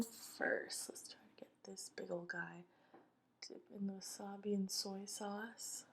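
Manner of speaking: soft breathy whisper. Real, not acted. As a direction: whisper it close to the mic. Whispering while preparing a bite.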